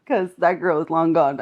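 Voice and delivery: ordinary talk, nothing marked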